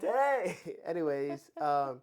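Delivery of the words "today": high, loud exclaim; excited shout. Real, not acted. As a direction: blurt it out eagerly. Loud excited echo of today.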